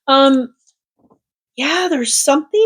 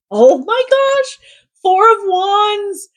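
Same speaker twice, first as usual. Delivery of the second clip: much higher and slow, thrilled at the card; excited gasp; 9 semitones higher, slower than usual. Real, not acted. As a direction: gasp it out in delight, drawing each word. Card drawn, reader delighted.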